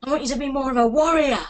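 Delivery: ordinary delivery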